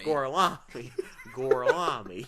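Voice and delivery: "Gorlami" drawn out slowly with a Southern twang, laughing; Southern-drawled Italian. Retold or imitated. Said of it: "talking like Jeff Foxworthy".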